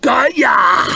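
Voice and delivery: high, rough shouted cry; triumphant shout. A narrator's reading. Fighter grabbing his foe in a great hug, shouting. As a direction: shout it out high as you grab him. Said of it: "he shouted".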